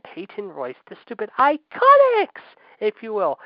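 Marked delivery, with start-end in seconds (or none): 0.9-2.4 high, loud sneering put-on voice; a much higher, louder jeering voice; mocking sneer. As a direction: spit the name out with contempt. Mocking the Iconics in a rant.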